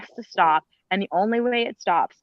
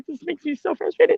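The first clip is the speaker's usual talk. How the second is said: emotional frustration; 9 semitones higher than usual. Real, not acted. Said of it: "I was getting emotional".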